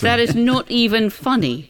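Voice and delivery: disapproving ticking-off; stern telling-off. Real, not acted. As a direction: scold them slowly, word by word. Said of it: "I feel as I've just been caned".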